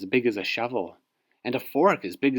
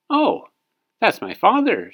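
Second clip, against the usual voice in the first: cheerful; 11 semitones higher, 7 dB louder than usual. Acted. David cheerfully explains.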